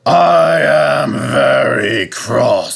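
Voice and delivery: cross controller. Acted. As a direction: spell out the anger slowly. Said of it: "said the fat controller".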